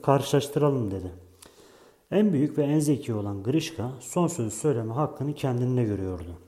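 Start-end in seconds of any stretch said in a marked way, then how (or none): none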